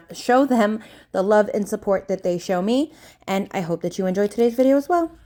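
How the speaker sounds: normal talking voice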